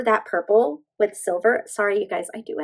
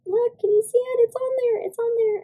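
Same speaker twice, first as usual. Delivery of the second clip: excited; 13 semitones higher than usual. Real, not acted. Excited over stamped nail design.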